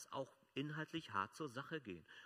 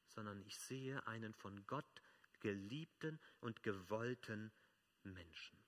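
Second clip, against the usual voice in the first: usual speaking voice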